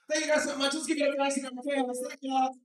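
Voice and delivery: loud, high emcee wrap-up; announcer sign-off. Real, not acted. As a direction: hype the crowd as you leave. Closing the set.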